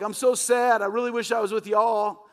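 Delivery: high, loud hammed-up whimper; mock sad. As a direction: fake a sorry little pout. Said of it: "you're not gonna see people going off to hell like".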